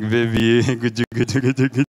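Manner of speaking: lightly voiced T-Rex cooing, half laughing; a hint of a clumsy dinosaur sweet-talking; dinosaur lover. T-Rex speaking to girlfriend.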